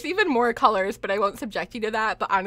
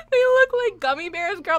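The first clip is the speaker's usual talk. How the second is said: higher and louder, tearful laughter; laugh-crying; 8 semitones higher, 6 dB louder than usual. Real, not acted. Streamer laughing about gummy bear suits. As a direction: squeal it through laughing tears.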